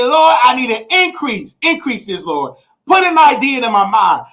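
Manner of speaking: hochgestimmtes Predigen mit lauten Rufen nach Lord; preaching prayer. Acted. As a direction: shout it out like a revival preacher. Leads prayer again.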